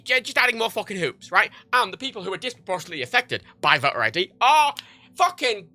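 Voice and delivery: ordinary delivery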